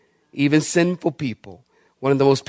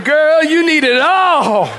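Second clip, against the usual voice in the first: laughing tease; 10 semitones higher than usual. Retold or imitated. Dousing with oil, laughing.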